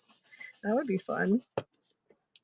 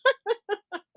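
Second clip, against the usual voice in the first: high laugh; 12 semitones higher, 4 dB louder than usual. Real, not acted. Laughing after her story.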